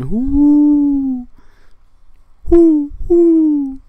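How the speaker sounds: long, high hooted "who"; owl-like hooting. Acted. Speaker drawing out "who" after talk of believing, hooting. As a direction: hoot it out high, loud and drawn out.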